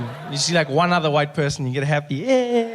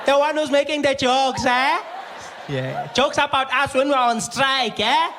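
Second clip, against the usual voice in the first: mocking receptionist; 12 semitones higher, slower than usual. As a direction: rub his jokes in his face. Receptionist mocks comedian.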